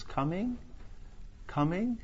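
high, soft fading echo. Retold or imitated. The father's call echoing down the corridors, soft and high. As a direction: let the words echo away down a hall. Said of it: "his voice would kind of reverberate down the corridors".

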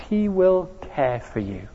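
hushed, gentle promise; tender. Shown as a demonstration. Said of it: "Can you sense the tenderness".

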